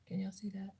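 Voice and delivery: hushed whisper to viewers. Real, not acted. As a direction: whisper it, close and secretive. Whispers while showing the mascara.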